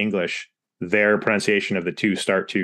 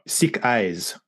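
put-on thick ice as "sick eyes", French-accented learner sounds; French slip, s for th and z for s; French speaker's mispronunciation. Retelling a French speaker, thick ice turned into sick eyes. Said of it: "also a French speaker was talking about".

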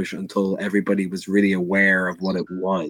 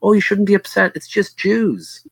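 much higher, put-on voice of a prim nun, slowing on the last words; mocking the nun teacher; 11 semitones higher than usual. Nun teacher dismissing the young girl's upset, mock high prim voice. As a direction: mimic a dismissive nun brushing off a child. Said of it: "And she goes".

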